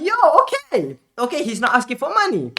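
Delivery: high, lightly hammed-up relieved reaction; excited relief. Streamer reacting to the scammer's message, mock excited relief.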